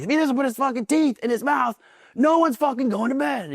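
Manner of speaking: high-pitched, mounting mock threat; acted angry bellow at a high pitch; increasingly aggressive instructor. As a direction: imitate a drill instructor escalating an ultimatum. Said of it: "he's getting more aggressive".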